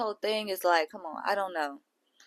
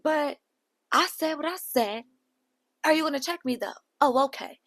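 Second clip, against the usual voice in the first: a higher, brushed-off voice of Nunu; mock a dismissive reality star; lightly voiced sassy reply; imitating Nunu; 7 semitones higher than usual. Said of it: "And Nunu's like".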